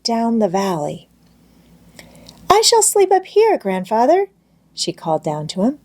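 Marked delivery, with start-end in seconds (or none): 2.5-4.3 much higher, calling from the hayloft; delighted child calling down. A narrator's reading. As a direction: call down to grandfather, thrilled. Heidi calls down from the loft.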